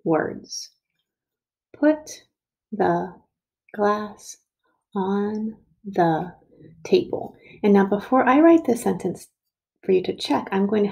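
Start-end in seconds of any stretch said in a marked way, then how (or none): 1.8-7.4 each word separate, with a pause after it; word-by-word dictation. Shown as a demonstration. Teacher dictating a sentence for children to count, word by word.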